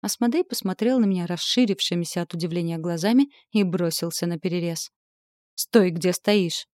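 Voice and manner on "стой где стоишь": slow, loud growled command; growled order. A narrator's reading. Heroine growls an order at the knight to freeze, slow and loud. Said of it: "рыкнула".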